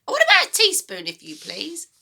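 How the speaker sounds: put-on high, loud, bossy old woman; a mean grandma giving orders, high and loud; grumpy grandma voice. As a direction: nag the boy like a sour old granny. Grandma demands.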